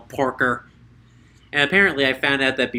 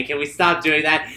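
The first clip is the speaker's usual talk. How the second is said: ordinary talk, nothing marked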